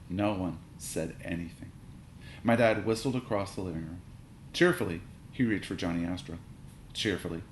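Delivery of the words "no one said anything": ordinary delivery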